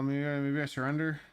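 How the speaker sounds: ordinary talk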